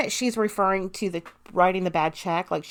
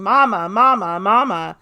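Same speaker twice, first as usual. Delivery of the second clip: louder sing-song repeated calls; louder, imitated repeated calling; retold calling for mama; 8 dB louder than usual. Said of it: "kept calling".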